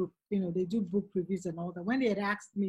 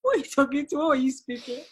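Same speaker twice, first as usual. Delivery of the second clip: put-on shocked kids; 4 semitones higher, 5 dB louder, faster than usual. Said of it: "They were a bit shocked".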